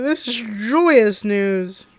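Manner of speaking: usual speaking voice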